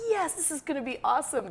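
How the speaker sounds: high, quick, gleeful quote; excited fan. Retold or imitated. Host recalling first seeing him perform, excited. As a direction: squeal it quickly like a thrilled fan. Retelling her delight at the show.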